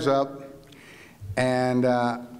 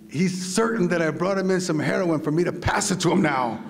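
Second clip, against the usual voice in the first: ordinary talk, nothing marked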